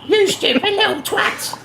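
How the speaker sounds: squeaky mock rant at the customer; mock Aussie shop worker. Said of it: "looked at me like I was weird".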